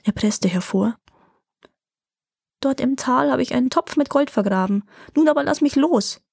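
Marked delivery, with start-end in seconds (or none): 2.6-6.2 higher, strained plea squeezed out in fear; the dwarf begging for his life; frightened, pitched-up gasping confession; terrified. A narrator's reading. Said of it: "zu Tode erschrocken".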